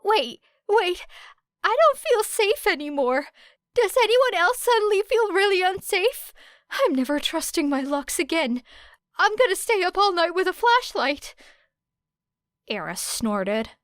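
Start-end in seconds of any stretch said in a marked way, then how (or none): 0.0-11.3 high, loud panicky voice; hammed-up nervous panic; nervous Kaminari. A narrator's reading. Kaminari panicking about Era's lockpicking, high and loud. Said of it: "Kaminari laughed nervously".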